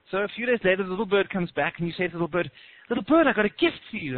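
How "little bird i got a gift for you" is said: high, louder sing-out to a pet; put-on excitement, pitched up; acted eager coaxing. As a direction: announce a surprise to a small bird. Luring the bird with a gift.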